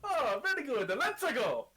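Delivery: put-on Italian accent. Shopkeeper voice held.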